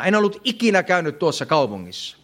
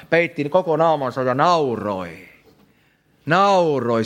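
ordinary talk

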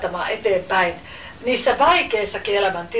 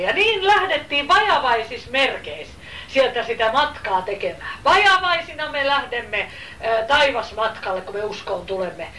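usual speaking voice

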